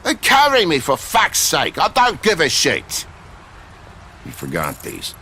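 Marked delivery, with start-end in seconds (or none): 0.0-3.0 loud, higher furious yell; angry shout. Acted. Man being rushed out snaps back in fury. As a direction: spit it out in a rage. Angry argument about leaving.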